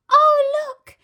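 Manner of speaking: very high, loud thrilled cry; children's excited cry. A narrator's reading. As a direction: gasp at the new toy. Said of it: "they cried".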